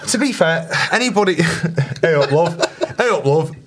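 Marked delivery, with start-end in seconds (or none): 2.0-3.5 light Yorkshire "hey up, love"; laughing Northern greeting; Northern accent. Retold or imitated. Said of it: "in a northern accent".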